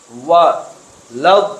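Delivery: ordinary talk, nothing marked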